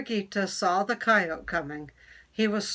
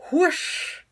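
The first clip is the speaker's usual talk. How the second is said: high, loud whoosh of a lunge; loud whooshing lunge into the pond; whoosh sound effect; 8 semitones higher, 5 dB louder than usual. Acted. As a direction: make the splash of a big lunge. Coyote lunges into water.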